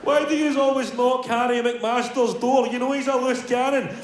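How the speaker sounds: put-on a kid near tears begging; whiny crying kid.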